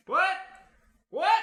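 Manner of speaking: high, short questioning shout; blacksmith yelling. Acted. Busy blacksmith answers a caller outside, high yell. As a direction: yell back over your work. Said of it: "You hear him yelling".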